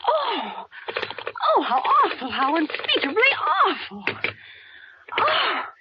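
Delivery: high gasping cries of horror; tearful horrified gasps. Acted. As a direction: recoil in shock and dread. Hears the murder plot, horrified.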